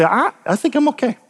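high, lightly mimicked self-assurance; self-excusing. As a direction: mimic someone hesitantly letting themselves off. Voicing self-satisfied people comparing themselves.

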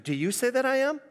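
"Do you say that I am" rising at the end; read as a question. Shown as a demonstration. Offering the line as a possible question, questioning. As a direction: ask it, lifting the voice on "am". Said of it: "It could be a question".

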